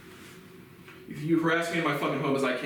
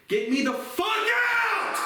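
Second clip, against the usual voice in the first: screams it high and stretched out; a raw, drawn-out shout at the top of the voice; furious shout; much slower than usual. Real, not acted. Ranting at the deputies, screaming to be let out.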